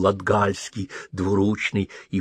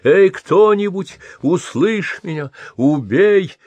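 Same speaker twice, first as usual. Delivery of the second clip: high, loud calling out into the dark; desperate shout; 9 semitones higher, 5 dB louder than usual. Acted. Blind old man shouting for someone to kill him. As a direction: shout for anyone to hear you. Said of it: "И скоро крик исчез".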